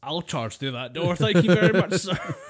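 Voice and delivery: loud, laughing mock bravado; hammed-up gallantry breaking into laughs. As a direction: puff up like a proud knight. Mock heroic quote, laughing.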